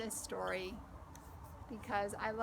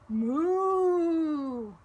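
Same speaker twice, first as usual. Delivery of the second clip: long loud moo; cow moo; 5 semitones higher, 8 dB louder than usual. Acted. Child mooing like cow.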